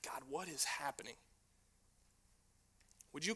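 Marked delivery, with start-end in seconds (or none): 0.0-1.1 breathy whispered plea to God; hushed, lost prayer; desperate whisper. Retold or imitated. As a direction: whisper it like you're overwhelmed. Retelling a desperate moment.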